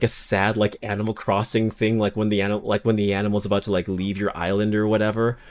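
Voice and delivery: ordinary talk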